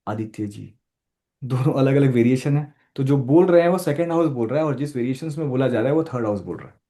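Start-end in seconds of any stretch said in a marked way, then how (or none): 0.1-0.7 soft voice. Shown as a demonstration. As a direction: say the name quietly and low. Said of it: "छोटी आवाज में बोलूँ".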